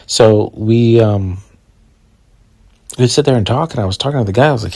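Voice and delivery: ordinary delivery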